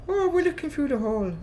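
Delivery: high-pitched, put-on Irish lilt; exaggerated Irish accent in a high voice; attempted Irish accent. Shown as a demonstration. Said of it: "my attempt at an Irish accent".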